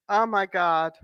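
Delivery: normal talking voice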